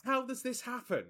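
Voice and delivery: high, loud disbelief, half laughing; "how does this happen?" in laughing disbelief; incredulous. Retold or imitated. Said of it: "And I'm thinking".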